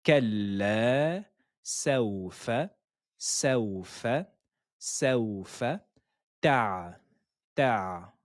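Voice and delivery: كلا سوف تعلمون broken into slow syllables. Shown as a demonstration. Teacher breaking the verse into syllables for students. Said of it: "Répétez avec moi, syllabe par syllabe".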